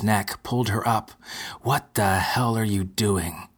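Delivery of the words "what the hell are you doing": plain reading voice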